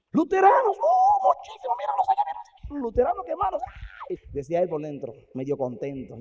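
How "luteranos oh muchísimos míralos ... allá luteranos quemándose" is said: high, loud crowing guide; gleeful shout. Acted. Hell's guide shouting to Wesley about burning crowds. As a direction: yell it out like a showman.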